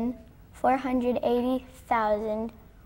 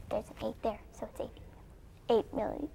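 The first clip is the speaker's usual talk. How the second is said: half-whispered working out; very soft, self-directed reckoning; thinking aloud softly; 9 dB quieter than usual. Real, not acted. Student working out answer to herself.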